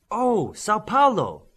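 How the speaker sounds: high, slow, delighted cry; a girl thrilled by the plan to visit home. A narrator's reading. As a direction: burst out with joy at the idea. Girl thrilled at the beach idea.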